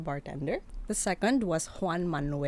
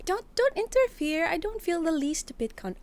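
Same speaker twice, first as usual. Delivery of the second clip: high; 10 semitones higher than usual. Acted. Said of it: "Molly was like".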